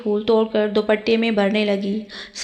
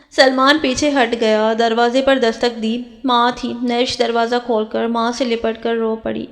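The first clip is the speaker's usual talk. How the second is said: ordinary delivery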